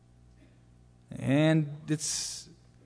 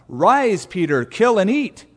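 raised, higher, louder divine command; commanding voice of God; 5 semitones higher, 6 dB louder, slower than usual. Acted. Voice from heaven ordering hungry Peter, loud command.

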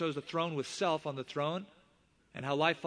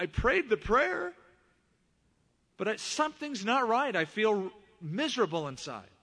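troubled wife; 7 semitones higher, 4 dB louder than usual. Retold or imitated. Voicing his wife's troubled confession.

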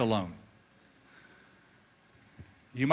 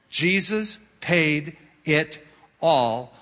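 very slow, solemn, each word weighted; slow hymn line; much slower than usual. Acted. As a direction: linger over every word like a hymn.

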